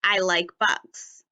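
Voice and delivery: "I like bugs" said slowly, each word given its room; careful slow reading; slow and spaced. Shown as a demonstration. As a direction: read it slowly with a small gap between words. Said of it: "my sentence should say".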